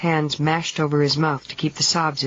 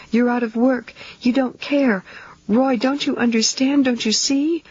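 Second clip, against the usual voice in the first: panicked outburst; 8 semitones higher than usual. A narrator's reading. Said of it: "she cried out in a burst of panic".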